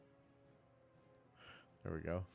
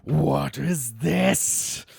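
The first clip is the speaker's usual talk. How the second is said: very loud, slow, higher-pitched roar; enraged goblin boss; 5 semitones higher, 10 dB louder, much slower than usual. Acted. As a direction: roar each word out slowly. Goblin boss slams axe, speaks.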